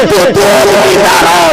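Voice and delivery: तू दूध पीता रहो shouted loud and slow, stressing तू; mother's shout. Acted. Mother urging her baby to drink, slow shout. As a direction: call it out like the devout mother. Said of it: "माँ कहती है".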